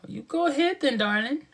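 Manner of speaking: playful high voice, lifting at the end; high-pitched cooing. Acted. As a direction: coo it way up high. Playful line said high, then repeated lower.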